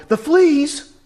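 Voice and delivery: high, loud disbelieving cry; shocked she must thank God for fleas; incredulous outburst. Retold or imitated. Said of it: "That was too much".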